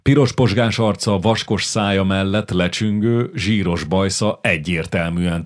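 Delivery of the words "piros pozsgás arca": plain reading voice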